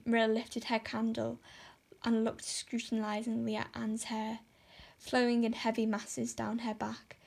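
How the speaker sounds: ordinary delivery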